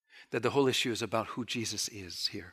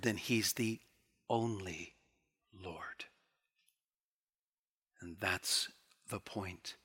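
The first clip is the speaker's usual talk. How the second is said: dropping to a near whisper on only Lord; hushed emphasis; 4 semitones lower, 8 dB quieter, slower than usual. Acted. Preacher closing the point softly to the congregation, nearly whispered. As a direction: let it fall quiet to land the point. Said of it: "hushed emphatic close".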